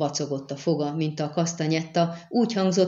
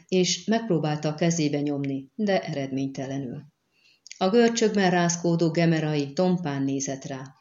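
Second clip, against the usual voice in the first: ordinary delivery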